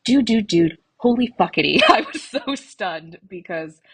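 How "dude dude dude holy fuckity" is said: usual speaking voice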